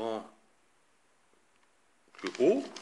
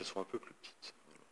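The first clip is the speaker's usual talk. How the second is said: whisper; 4 semitones lower, 13 dB quieter, faster than usual. Real, not acted. Muttered remark while writing.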